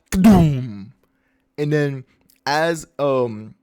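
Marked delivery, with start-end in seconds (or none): 0.1-0.9 loud imitated impact boom; cartoon crash. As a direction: boom it out like an explosion. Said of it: "they crash".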